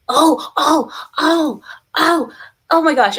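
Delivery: high, loud burst of delight; excited exclamation. Real, not acted. Reacts to a donor's news.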